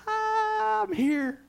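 high squeal of arrival joy; excited squeal. Retold or imitated. Driver reaching Florida at last, high excited squeal. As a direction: squeak it out like a thrilled kid.

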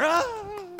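high loud mock crowd cheer; crowd roar imitation. Preacher imitating a cheering crowd, loud and high. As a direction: roar like the whole room cheering.

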